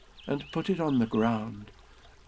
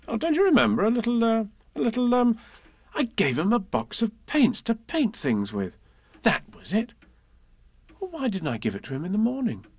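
put-on much higher, fretful boy's voice; a little boy fretting he forgot; worried child; 11 semitones higher than usual. Christopher Robin fretting about forgotten present, high and anxious.

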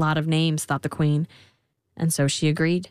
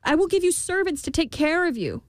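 higher, earnest queen voice; pleading queen; 11 semitones higher than usual. A narrator's reading. Queen bargaining with the imp, higher pleading. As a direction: offer it like a worried mother. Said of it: "said the queen".

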